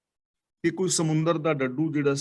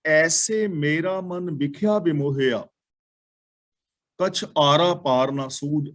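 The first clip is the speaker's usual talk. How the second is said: slow measured recital of the verse; slow devotional recital of the tuk; verse recitation; slower than usual. Acted. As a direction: lay out each word of the holy line slowly. Recites next verse line.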